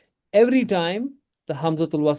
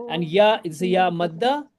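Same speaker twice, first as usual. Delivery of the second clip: normal talking voice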